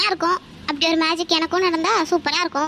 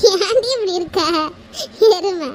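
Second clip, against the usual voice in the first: laughing; 2 semitones higher than usual. Acted. Friend teasing her upset friend over rose milk, laughing. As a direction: laugh fondly while mock-scolding.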